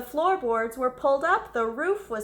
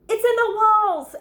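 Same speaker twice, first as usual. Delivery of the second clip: high, loud screech; 10 semitones higher, 9 dB louder than usual. Acted. Owl screeching at the noise in his walls. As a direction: shriek it in panic. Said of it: "Owl screeched".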